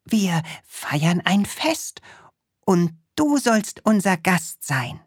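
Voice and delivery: put-on high, eager little boy voice; Krümel voice. Little Krümel inviting the witch to a party, high and eager.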